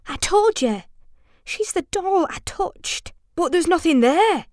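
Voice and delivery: put-on high, urgent child protesting; anxious insistence. A narrator's reading. Frightened child quoted in scary story.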